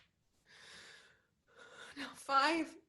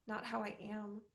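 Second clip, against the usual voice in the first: normal talking voice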